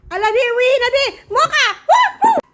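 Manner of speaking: loud, high call across the yard; calling the dog. Real, not acted. Owner calling her pet over, loud high call.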